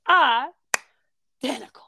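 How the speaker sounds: slow, loud drawl, dramatically stretched; Southern accent. Retold or imitated. Prosecutor stressing identical to the jury, dramatic Southern accent. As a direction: draw out the first vowel, Southern style. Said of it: "With a Southern accent".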